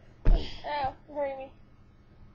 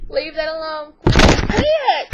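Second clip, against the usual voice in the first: loud scolding; 14 dB louder than usual. Real, not acted. Scolding child loudly.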